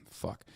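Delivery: a heavy sigh, then a breathy, muttered curse. Real, not acted. Commentator reacting to a harrowing message with a sighed curse. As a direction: let the air out and curse under your breath. Reading a painful text aloud.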